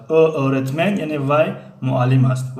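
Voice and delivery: normal talking voice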